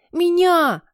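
loud, very high cry; eager shout. A narrator's reading. Classmates shout to be chosen, high yell. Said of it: "крикнули новенький Ник, прожорливый Пол".

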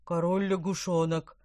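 fish voice crying out; gurgling shout. Acted. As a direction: cry out in alarm. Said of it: "крикнул Помпон".

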